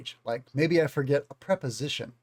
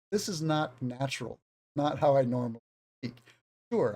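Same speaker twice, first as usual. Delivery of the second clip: ordinary talk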